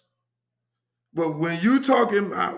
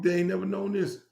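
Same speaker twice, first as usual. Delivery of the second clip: ordinary delivery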